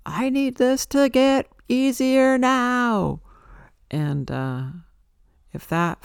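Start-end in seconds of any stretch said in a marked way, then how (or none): 0.0-3.2 much higher, louder, slow drawn-out plea; yelling at God. Retold or imitated. Retelling how she yelled at God, a loud slow demand. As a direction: cry it up to the sky, demanding. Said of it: "I yelled at God".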